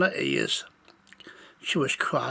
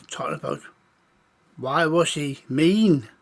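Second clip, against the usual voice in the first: ordinary delivery, nothing marked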